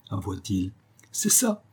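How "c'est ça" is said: much higher, excited exclamation; impetuous cry. A narrator's reading. Calinette confirming what James admitted, impetuous cry. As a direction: jump in eagerly on a high pitch. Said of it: "cria l'impétueuse Calinette".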